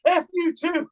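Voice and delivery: high-pitched comeback, imitating a buddy; mock retort. Friend retorts to rival fans.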